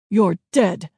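loud threat at natural pitch; gruff yell. A narrator's reading. Gunman to the hiding children, gruff yell. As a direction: shout it as a menacing threat. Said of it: "he yelled".

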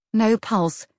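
usual speaking voice

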